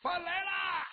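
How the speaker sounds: high, loud call from outside. Acted. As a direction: announce the food from the doorway. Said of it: "就听外边有人喊".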